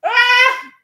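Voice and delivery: high squealing laugh ending in a cry; real laughing fit with a loud high "Ah!"; helpless laughter. Real, not acted. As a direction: laugh till your side hurts, pitch way up.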